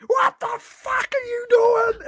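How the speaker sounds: retold rage at the footy, slow and screamed; furious shout. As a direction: explode at the TV. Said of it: "And I'm just losing the plot".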